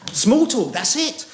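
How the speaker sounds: high, loud "Small talk, that's it"; mock triumphant. Stranded at a party, triumphantly high and loud. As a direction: crow it out like a discovery. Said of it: "mock exclamation".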